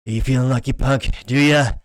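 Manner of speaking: hammed-up movie gunslinger, punching the last words; Clint Eastwood impression. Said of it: "do a Clint Eastwood accent".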